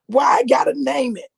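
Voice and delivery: high, loud mock-crying whine; hammed-up tearful complaint; whiny protest. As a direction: whine it like a put-upon child. Acts out her protest to God.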